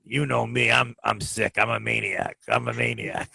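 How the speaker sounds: low, soft, sheepish tough-guy voice; low apologetic mobster voice; John's voice on the phone. Retold or imitated. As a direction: play the remorseful hothead, low and hushed. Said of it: "Here's the voice I get".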